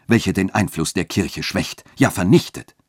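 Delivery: indignant churchman working himself up. A narrator's reading. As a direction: rise into an agitated complaint. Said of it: "sagte der Dechant aufgebracht".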